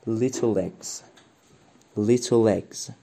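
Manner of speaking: little eggs run together as one phrase; linked little eggs. Shown as a demonstration. Teacher modelling little eggs linked for students. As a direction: link little into eggs smoothly.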